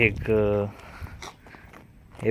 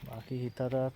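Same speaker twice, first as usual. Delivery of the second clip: quiet vlog aside; 10 dB quieter than usual. Real, not acted. Parent tells the camera what they are doing next, soft and quiet. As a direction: talk softly, just for the viewers.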